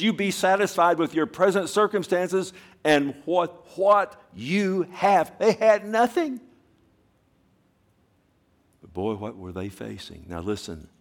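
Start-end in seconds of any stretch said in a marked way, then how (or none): 0.0-2.5 high loud preaching. Shown as a demonstration. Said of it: "repeat louder". 2.8-6.4 high, loud impassioned preaching; preaching at full force. Acted. Preacher urging the church to be content, fervent.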